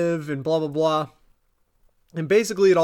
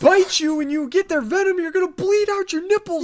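mock panicked guy; 11 semitones higher, 4 dB louder, faster than usual.

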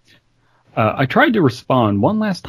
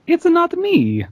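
much higher, slow pleading denial; high character voice. Acted. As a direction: plead innocence in a squeaky voice. Character line, then repeated plainly.

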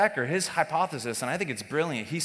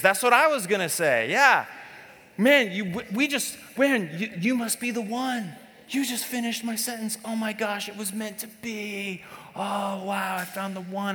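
mock starry-eyed couple; 7 semitones higher than usual. Preacher acting out lovestruck couple finishing each other's sentences, high gushing. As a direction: gush in a high voice like new lovers. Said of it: "acting out a couple finishing sentences".

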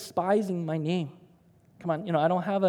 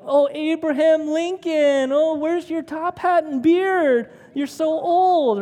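high, slow mocking taunt; kids mocking; 7 semitones higher, 6 dB louder, slower than usual. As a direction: tease him like a schoolyard kid. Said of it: "say my name in a mocking manner".

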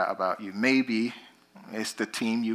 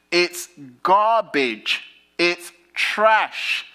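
higher, louder, slow "garbage" thrown away; preaching peak; 7 semitones higher, 6 dB louder, much slower than usual. Acted. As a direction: spit out "garbage" with a long fall, higher and louder.

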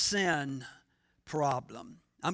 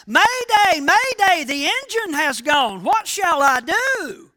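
much higher, louder desperate yell; frantic mayday shout; 8 semitones higher, 6 dB louder than usual. Retold or imitated. Pilot screaming mayday to the control tower, much higher and louder desperate yell. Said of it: "This time he shouted".

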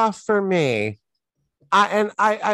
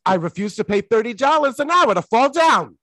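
punchy, higher comic zinger; Borscht Belt comic delivery; 8 semitones higher, 4 dB louder than usual. Retold or imitated. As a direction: sell it like a Catskills comic. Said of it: "delivered with this".

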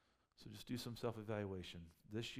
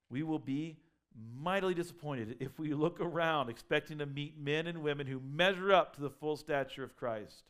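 slow, then louder and louder preaching; preaching at full force; 4 semitones higher, 8 dB louder, slower than usual. Acted. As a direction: build it up from the pulpit.

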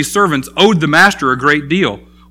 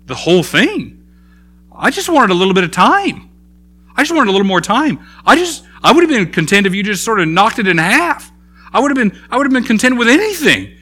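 stunned relief; 6 semitones higher than usual. Acted. Servant's stunned relief voiced.

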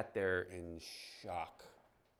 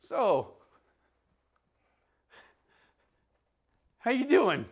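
loud, much higher, startled-sounding "how you doing"; awkward opening line; 18 dB louder, slower than usual. Retold or imitated. Preacher to congregation, replaying his awkward greeting, loud and high. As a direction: blurt out a clumsy greeting at a patient. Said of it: "this was my opening line".

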